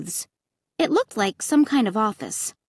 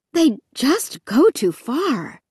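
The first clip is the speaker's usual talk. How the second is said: breathlessly; 4 semitones higher, slower than usual. A narrator's reading. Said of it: "Mom said breathlessly".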